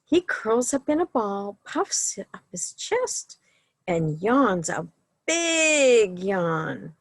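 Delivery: lively, sing-song picture-book reading; reading to children. A narrator's reading. Librarian reading a bedtime story to children, sing-song. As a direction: read the bedtime book to the little ones.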